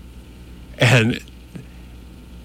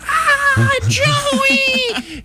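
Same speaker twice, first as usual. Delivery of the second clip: excited shout. Retold or imitated. Retelling a co-editor greeting him with a high, excited shout. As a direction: shriek the name like meeting a star. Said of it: "She gets up and goes".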